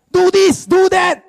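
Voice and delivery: high, loud mock commands; bossy ordering. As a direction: order a husband about, exaggerated. Acting a bossy wife ordering husband.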